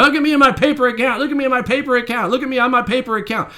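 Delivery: high, fast, mocking brag; mocking bragging voice. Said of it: "Be like".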